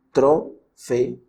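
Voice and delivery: trofeo slowly split as tro-fe-o; syllable by syllable. Shown as a demonstration. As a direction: separate the final e and o of trofeo into two syllables. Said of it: "tienen que pronunciarse siempre".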